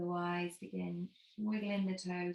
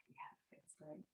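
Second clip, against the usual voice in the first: breathy aside; 15 dB quieter than usual. Real, not acted. Enjoying the stretch mid-class.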